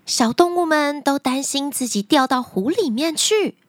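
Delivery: plain reading voice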